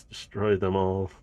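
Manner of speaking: usual speaking voice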